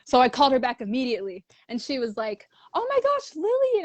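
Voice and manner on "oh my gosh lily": excited greeting. Retold or imitated. Voicing Jen's excited greeting.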